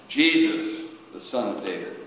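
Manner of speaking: ordinary talk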